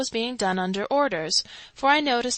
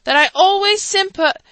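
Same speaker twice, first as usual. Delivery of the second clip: louder, higher thin speech; louder, higher squeaky repeat; squeaky orator; 11 semitones higher, 10 dB louder than usual. A narrator's reading. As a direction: squeak it again over the noise. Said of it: "he repeated".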